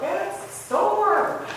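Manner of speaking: high, slow, drawn-out mock worry; mock disciple. Disciples telling the sleeping Jesus, in a high, slow mock voice. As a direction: stretch out "storm" in a high, slow, silly voice.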